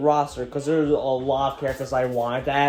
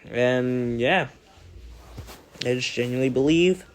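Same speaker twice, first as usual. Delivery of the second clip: normal talking voice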